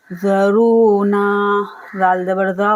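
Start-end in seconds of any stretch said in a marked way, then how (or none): 0.1-1.2 slow recited word; Quran recitation. Shown as a demonstration. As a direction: chant the word slowly. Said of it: "रू और ना दोनों को खींचना है".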